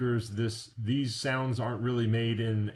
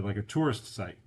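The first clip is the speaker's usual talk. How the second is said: ordinary talk, nothing marked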